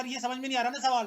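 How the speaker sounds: student shouting his question at the teacher; shouted question. Retold or imitated. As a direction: yell it so the deaf teacher hears. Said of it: "मैंने चीख कर बोला".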